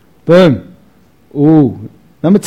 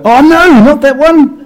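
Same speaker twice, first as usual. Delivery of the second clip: surprised oh no; 11 semitones higher, 5 dB louder, slower than usual. Real, not acted. Wrong slide clicked.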